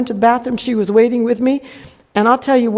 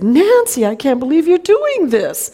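higher-pitched, disbelieving telling-off; dismayed teacher; 5 semitones higher than usual. Retold or imitated. Teacher catches Nancy fighting, shocked reproach.

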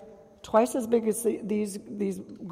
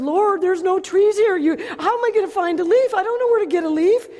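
much higher and louder plea to God; retold protest; 11 semitones higher, 9 dB louder than usual. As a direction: protest to the Lord in alarm. Protesting to the Lord.

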